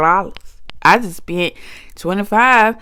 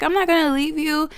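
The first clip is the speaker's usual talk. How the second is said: grandma voice; 7 semitones higher than usual. Retold or imitated. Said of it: "She's like".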